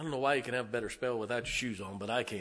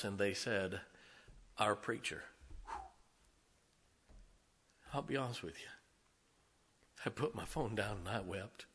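much softer, near tears with joy; hushed, moved confession; 3 semitones lower, 6 dB quieter than usual. Real, not acted. Preacher tells the church his text moved him, softly. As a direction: speak it low, choked up.

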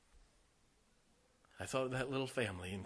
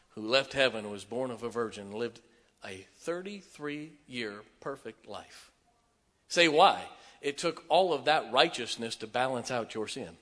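full-force preaching; 9 dB louder than usual. Real, not acted. Pastor preaching salvation to the room, shouting fervently. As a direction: preach it hard, raise the roof.

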